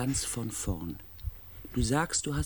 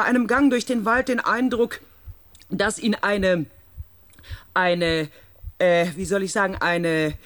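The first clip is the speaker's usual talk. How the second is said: loud, higher, slow into the radio; loud radio talk; 8 semitones higher, 10 dB louder than usual. Acted. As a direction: raise your voice for a bad radio link. Speaking loudly over radio link.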